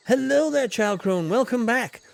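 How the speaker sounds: loud, high sing-song hello; hearty greeting. Acted. Explorer hails Child Crone, loud and high. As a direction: call out a big cheery welcome.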